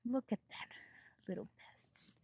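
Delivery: muttered contempt; whispered scorn. Real, not acted. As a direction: hiss at the little pests under your breath. Scolding pests on the plants.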